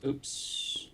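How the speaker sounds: breathless whispered oops. Real, not acted. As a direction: whisper it, caught off guard. Quiet mistake aside.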